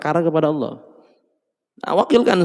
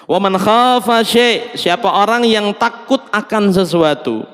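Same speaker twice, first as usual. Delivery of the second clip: higher, slow intoned recitation; recited text; 7 semitones higher than usual. Shown as a demonstration. Preacher intones the hadith text for listeners, slow and lilting. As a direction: intone the Arabic like scripture.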